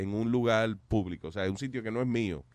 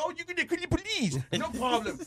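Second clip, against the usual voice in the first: high sarcastic taunt; mocking. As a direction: scoff at the threat, pitched way up. Mocking the manager's threat. (burla sarcástica y aguda)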